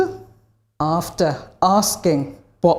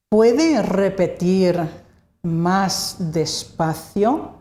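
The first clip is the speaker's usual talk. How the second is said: "Puede repetir más despacio" slowly, in separated chunks; slow model phrase; much slower than usual. Shown as a demonstration. Teacher models phrase for learners.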